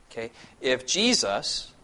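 normal talking voice

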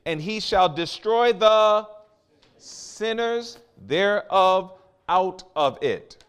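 loud, slow, ringing scripture read; booming pulpit delivery; full-force preaching. Acted. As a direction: preach it out to the whole church, drawing out the key lines. Thunders judgment scripture.

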